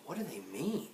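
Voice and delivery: very soft, inward voice; private thought. A narrator's reading. Jack puzzles over the hecklers, hushed. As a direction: think it aloud under the breath. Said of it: "thought Jack".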